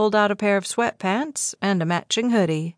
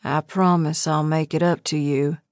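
a touch husky, lower man's voice; 4 semitones lower than usual. Acted. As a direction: drop into a husky promise. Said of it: "His voice dropped a few notches and settled into the husky tone".

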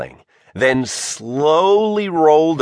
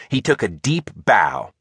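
ordinary delivery, nothing marked

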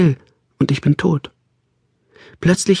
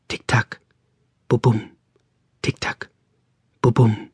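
soft alternating Tick-Tack and Bubum, each quieter; ticking and heartbeat; 2 semitones lower, 3 dB quieter than usual. A narrator's reading. Narrator voicing clock and heart beating in turn. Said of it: "Wechselspiel von Tick-Tack und Bubum".

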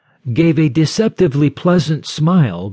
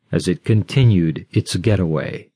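ordinary delivery

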